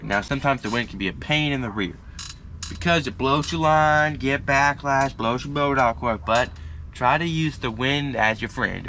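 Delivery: ordinary talk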